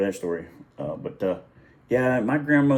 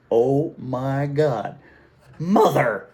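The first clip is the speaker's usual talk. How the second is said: loud, higher, drawn-out exclamation; barked-out outburst; 4 semitones higher, 6 dB louder, much slower than usual. Retold or imitated. Mom on seeing the collage, barking out in disbelief. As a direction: bark it out in shocked protest. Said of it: "And she barked out".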